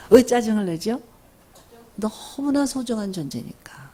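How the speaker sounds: usual speaking voice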